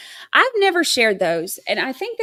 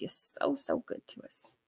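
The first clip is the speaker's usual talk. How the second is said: whispered thanks; 4 semitones higher, 12 dB quieter than usual. Real, not acted. Streamer whispering thanks to her donor. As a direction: breathe the thanks softly.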